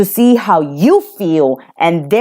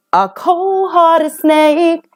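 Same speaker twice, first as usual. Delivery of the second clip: drawn out slowly and higher; emphatic; 8 semitones higher, slower than usual. Real, not acted. Reader warns the viewer about the ex, slow higher emphasis. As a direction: lean into "cold-hearted snake" slowly.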